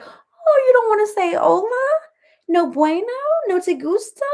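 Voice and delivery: high singsong needling in bits of Spanish; playfully taunting mom, high and exaggerated; mock-innocent teasing. Mom mocks son about Spanish teacher.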